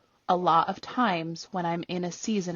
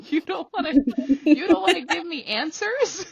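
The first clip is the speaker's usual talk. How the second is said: higher, louder sarcastic complaint; mock indignation; 9 semitones higher, 5 dB louder than usual. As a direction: whine at the injustice, hammed-up. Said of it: "people who can run anxious".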